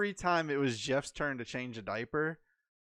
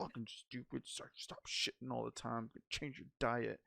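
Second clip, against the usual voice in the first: much softer muttered cursing; cursing under his breath; 9 dB quieter than usual. Retold or imitated. Dad Jeff on his knees at the diaper, cursing under his breath. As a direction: grumble it to yourself while changing a diaper. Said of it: "just cursing to himself".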